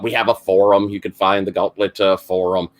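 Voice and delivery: ordinary talk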